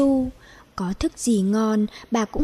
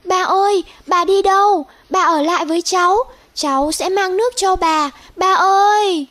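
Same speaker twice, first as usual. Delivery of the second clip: put-on high, loud, desperate child's cry after the flying bird; panicked cry; 7 semitones higher, 5 dB louder than usual. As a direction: shout after her, frightened, pleading. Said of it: "hoảng quá kêu lên". (tiếng kêu hoảng hốt thảm thiết)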